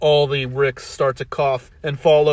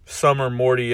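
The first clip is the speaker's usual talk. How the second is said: ordinary delivery, nothing marked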